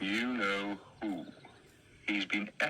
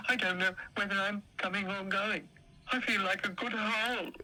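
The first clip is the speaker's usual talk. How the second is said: dog character voice; 7 semitones higher than usual. Acted. Dill's voice.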